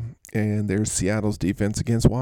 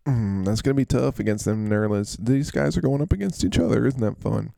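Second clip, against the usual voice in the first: ordinary talk, nothing marked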